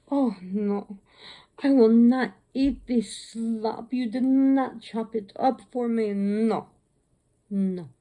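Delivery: slow, emphatic, drawn-out indignant refusal; Monkey. Retold or imitated. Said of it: "I could picture Monkey talking if she had a human voice".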